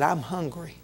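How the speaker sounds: imitating a hungry child, low and very soft; hushed, low reply of an upset little boy. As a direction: drop to a small, quiet confession. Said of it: "in a little old low voice".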